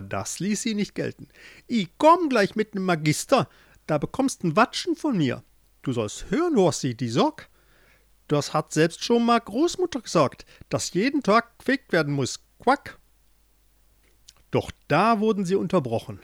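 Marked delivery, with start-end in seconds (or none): 1.7-13.0 high, loud nagging mother in dialect; scolding frog mother. A narrator's reading. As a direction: scold him with a croaking quack. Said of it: "schimpfte die Mutter". (schimpfende Froschmutter mit Dialekt)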